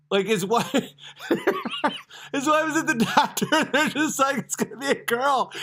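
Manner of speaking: high-pitched, cracking-up storytelling; real giggly retelling; telling it through laughter. Real, not acted. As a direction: struggle to get the story out while laughing.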